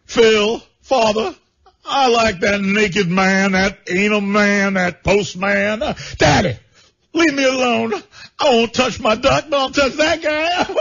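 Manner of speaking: high, slow, mock-tearful confession; hammed-up weepy son. Son confesses to his father, high and mock weeping.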